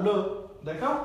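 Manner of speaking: usual speaking voice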